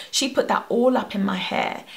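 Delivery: normal talking voice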